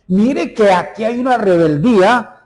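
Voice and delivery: loud, high, forceful sermon voice; fiery preaching. Real, not acted. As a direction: drive the warning home from the pulpit. Preaching climax.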